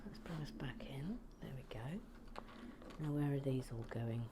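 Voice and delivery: quiet unvoiced whisper while working; hushed ASMR-style whisper; soft whisper. Real, not acted. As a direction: whisper to the camera. Whispered craft talk.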